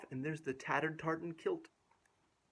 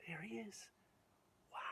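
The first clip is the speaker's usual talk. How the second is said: soft near-whisper pointing him out; hushed; 5 semitones higher, 14 dB quieter than usual. Real, not acted. Hushed aside.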